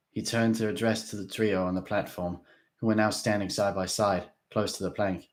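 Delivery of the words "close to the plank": usual speaking voice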